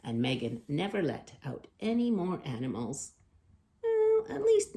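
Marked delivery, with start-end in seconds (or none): 3.8-4.8 cheeky. A narrator's reading. Cheeky closing punchline.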